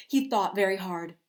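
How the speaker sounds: ordinary delivery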